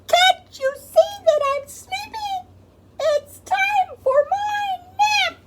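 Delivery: very high, loud little bird voice; sleepy baby bird. Acted. Baby bird complains it needs its nap. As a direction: whine it as a tiny tired bird.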